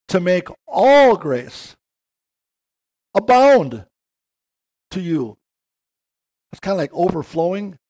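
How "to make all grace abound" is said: "all" drawn out slowly and loud, "abound" pushed high and loud; slow, loud preaching with "all" leaned on hard; emphasis on all. Acted. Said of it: "Notice the emphasis on all here".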